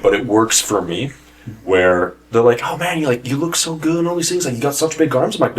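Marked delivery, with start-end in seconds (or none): 2.6-5.4 mock gushing coworker. Coworkers' compliments voiced.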